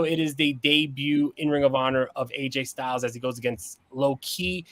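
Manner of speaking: usual speaking voice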